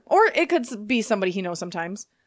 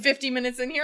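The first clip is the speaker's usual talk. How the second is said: ordinary talk, nothing marked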